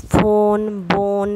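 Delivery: ফোন, বোন with a long 'oh'; long o sound. Shown as a demonstration. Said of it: "ও উচ্চারণ হয়". (ফোন বোন-এ লম্বা ও ধ্বনি)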